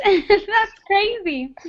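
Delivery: slow amused disbelief with a laugh. Real, not acted. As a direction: laugh it out, amazed. Student never heard English media.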